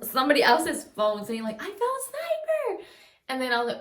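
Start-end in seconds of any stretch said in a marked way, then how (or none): 1.6-2.8 high, drawn-out imitation of an excited sister; over-exaggerated cry. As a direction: squeal it out long, mimicking your little sister. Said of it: "she tends to over-exaggerate".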